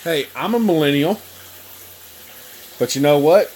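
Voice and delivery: usual speaking voice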